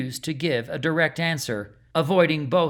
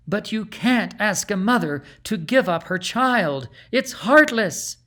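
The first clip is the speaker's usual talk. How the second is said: high, loud outraged plea; rising to a cry on heartless; a mother's anguish turned to accusation; anguished protest; 6 semitones higher, 4 dB louder than usual. A narrator's reading. Beatrice protesting.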